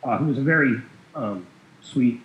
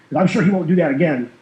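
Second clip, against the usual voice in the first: manly correcting; 4 semitones higher, 6 dB louder than usual. Retold or imitated. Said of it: "his, his manliness".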